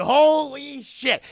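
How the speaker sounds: loud, high, drawn-out dismay; mock alarm. Captive hears him coming, slow loud mock alarm. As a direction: stretch out the retold shock.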